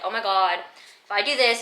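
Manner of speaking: usual speaking voice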